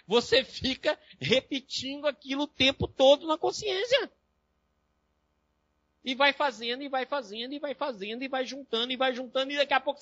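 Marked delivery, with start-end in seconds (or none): 0.1-7.7 mock whining. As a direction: drag it out in a high, put-on cry. Mimics crying obsessive repetition. (choramingo fingido, lento e agudo)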